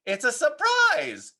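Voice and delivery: Froggy yelling to mom; yelled. A narrator's reading. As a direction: call it out proudly. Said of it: "yelled Froggy".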